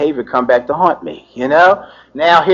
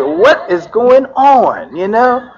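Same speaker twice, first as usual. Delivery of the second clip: incredulous; 8 semitones higher, much slower than usual. Retold or imitated. Judge seeing him charged.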